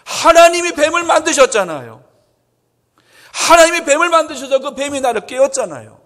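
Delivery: loud it is God's fault; aggrieved high complaint; indignant blaming. Acted. Eve blaming God, acted.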